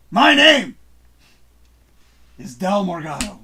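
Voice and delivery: announcer sign-off. Acted.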